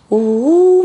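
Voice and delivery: high, loud howling oh; mimicking the wolf-like cry from the next room. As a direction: howl like a wolf. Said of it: "막 무슨 늑대 소리를 내는 거야".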